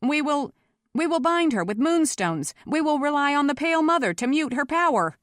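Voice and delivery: high, urgent proposal with a false start; improvising frantically. A narrator's reading. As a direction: make it up as you go, pressing the crowd. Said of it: "he was improvising frantically".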